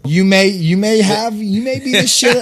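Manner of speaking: mock telling-off. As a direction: tell him off with swagger. Telling off the surgeon.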